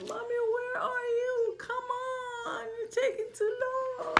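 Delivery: put-on high whimpering baby voice; mock crying baby. Mother reading, baby llama missing mama, whimpering. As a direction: play the sad baby, whining. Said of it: "He's like".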